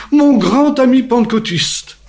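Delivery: high, loud and slow, announcing it to the whole room; loud proclamation. Retold or imitated. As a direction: boom it out proudly across the dining hall. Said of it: "Il a dit ça d'une voix forte". (proclamation forte, aiguë et lente)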